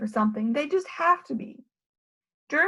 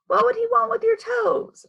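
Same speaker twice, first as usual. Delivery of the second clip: high puzzled teacher; 9 semitones higher, 5 dB louder than usual. Acted.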